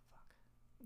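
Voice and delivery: a sigh, then a breathy whispered curse. Retold or imitated. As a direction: let it slip out under your breath in defeat. Realizing the Marines stood up.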